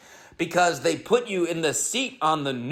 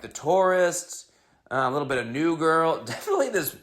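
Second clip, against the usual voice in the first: ordinary talk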